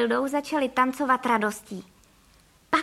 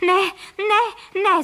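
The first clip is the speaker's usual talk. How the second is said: high, loud pleading cries; loud, high protest; frightened cry; 7 semitones higher, 6 dB louder, much slower than usual. A narrator's reading. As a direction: cry out no in panic. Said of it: "zvolali pan Frejka a paní Frejková".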